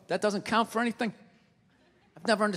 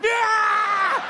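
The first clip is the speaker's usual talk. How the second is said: long, high lunging scream; drawn-out high attack howl; dog attack yell; 12 semitones higher, 6 dB louder than usual. Acted. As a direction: scream like a dog going for someone. Dog lunges at a passerby.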